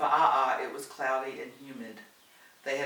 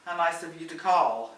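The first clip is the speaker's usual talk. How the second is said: sarcastic; 3 semitones higher, 8 dB louder than usual. A narrator's reading. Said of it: "Alice said sarcastically".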